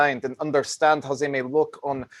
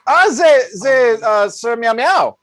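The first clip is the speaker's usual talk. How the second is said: grand announcement; 8 semitones higher, 8 dB louder than usual. Acted.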